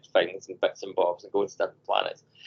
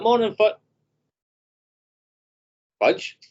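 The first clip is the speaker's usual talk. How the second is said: surprised greeting; 12 semitones higher, 5 dB louder than usual. Retold or imitated. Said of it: "I was like".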